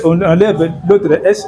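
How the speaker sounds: ordinary talk, nothing marked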